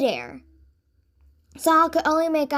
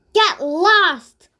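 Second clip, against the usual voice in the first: defiant shout; 6 semitones higher, 8 dB louder than usual. Acted.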